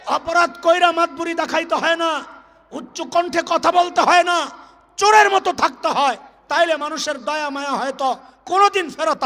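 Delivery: fiery preaching. Acted. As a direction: thunder at the wrongdoers from the pulpit. Fiery rebuke of wrongdoers. (উঁচু গলায় তেজি ওয়াজ)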